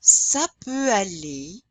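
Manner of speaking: slow French "Ça peut aller", ending ah-LAY. Shown as a demonstration. Teacher models slowly, "aller" ending in ay. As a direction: draw out "Ça peut aller", no R at the end. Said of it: "the E R is pronounced A".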